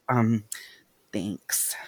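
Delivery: small, soft and hesitant thanks; mock-shy, with a pause before thanks; quirky girl moment. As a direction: imitate an awkward, quirky girl. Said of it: "quirky girl moment".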